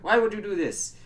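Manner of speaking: acted-out exasperated protest; indignant accusation. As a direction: retell it as an indignant demand. Acting out an accusation in retold story.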